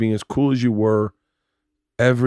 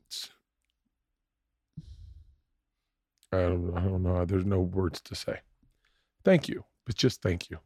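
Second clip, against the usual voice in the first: choked up; 3 semitones lower, 7 dB quieter than usual. Real, not acted. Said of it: "I'm gonna get emotional now".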